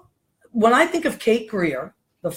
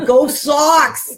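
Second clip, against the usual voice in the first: slow, higher, louder Boston cheer with a laugh; Boston sports fan cheer; 5 semitones higher, 4 dB louder than usual. Shown as a demonstration. Host shows a Boston fan cheering the Sox, drawn-out Boston yell. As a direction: bellow it like a Sox fan in the stands. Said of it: "this is what it sound like".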